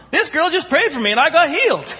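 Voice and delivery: healed woman yelling to the plane, thrilled; excited yell. Retold or imitated. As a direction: shout the news, overjoyed. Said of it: "this lady starts yelling".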